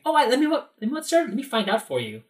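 very high, sing-out helpful voice; cheery employee. Retold or imitated. Visitor imitates how a Disney employee should offer help, cheery. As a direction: put on an eager-to-please customer service voice. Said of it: "obviously be cheery".

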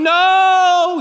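sharp high loud "No"; shouting. Retold or imitated. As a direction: shout it out in alarm. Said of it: "John over here shouting".

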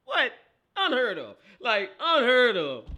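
high, loud, emphatic outrage; outraged disbelief. Real, not acted. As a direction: cry out in disbelief, stretching the words. Outraged at the upset loss.

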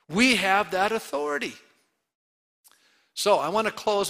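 loud, firm sermon declaration; forceful preaching. Acted. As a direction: declare it to the church.

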